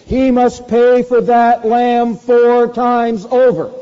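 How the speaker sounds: slow, loud and very high, hammering each word; indignant verdict. Acted. David passing judgment on the rich man, slow and booming. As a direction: pronounce the sentence like an outraged king. David pronounces the law's penalty.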